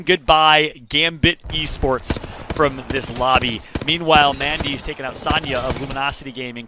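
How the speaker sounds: loud, rising esports call as the teams fall; live play-by-play. Acted. Caster calling eliminations to the viewers, loud excited play-by-play.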